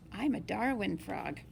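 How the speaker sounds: ordinary delivery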